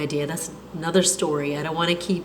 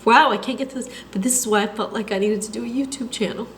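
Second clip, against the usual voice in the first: after crying; 4 dB quieter than usual. Real, not acted. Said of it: "Don't mind if I'm a mess right now".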